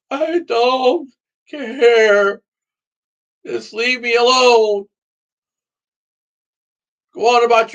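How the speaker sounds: real shouting rant at a sister, building up; emotional outburst. Real, not acted. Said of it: "I'm getting way too emotional".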